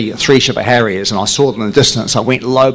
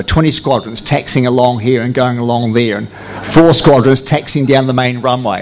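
usual speaking voice

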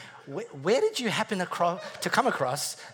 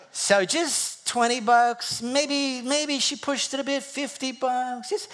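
mock musing; 9 semitones higher, slower than usual. Mock musing over small withdrawals.

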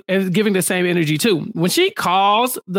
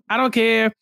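usual speaking voice

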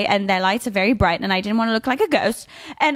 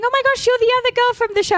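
much higher and fast; imitating excited fans; 11 semitones higher, faster than usual. Host imitating fans at the summit, fast high gush. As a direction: gush quickly like a starstruck fan. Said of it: "people were coming up to me saying".

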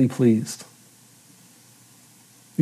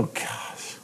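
regretful groan; 6 semitones lower, 11 dB quieter, much slower than usual. Retold or imitated. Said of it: "you just go".

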